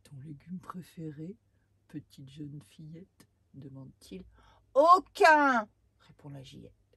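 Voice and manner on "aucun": put-on defiant girl. Girl refuses vegetables.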